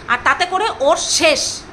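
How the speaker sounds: slow, deliberate, rising at the end; slow threat. Real, not acted. Vlogger warning the former friend, slow and menacing. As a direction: drop each word like a warning.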